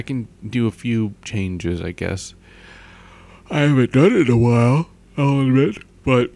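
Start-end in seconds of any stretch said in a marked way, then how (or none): 3.5-6.3 put-on higher, louder girl voice. Shown as a demonstration. Vlogger showing off voice changes, high girl voice. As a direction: pitch it up into a girl voice. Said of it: "I like changing my voice".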